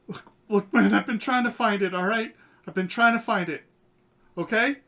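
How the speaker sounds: high, loud, frantic begging; panicked pleading. Acted. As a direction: plead fast, scared for his life. Terrified NPC pleading at gunpoint.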